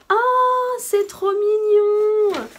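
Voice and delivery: high, loud, slow gush. Real, not acted. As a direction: stretch out "mignon" in delight. Gushing over cute item again.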